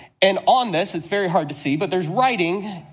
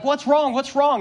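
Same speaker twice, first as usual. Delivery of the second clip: urgent question; 7 semitones higher than usual. Retold or imitated. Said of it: "and I'm like".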